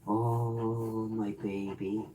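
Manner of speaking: soft, slow pet sympathy; soft cooing sympathy. Real, not acted. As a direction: murmur softly to the dog. Soothing the dog.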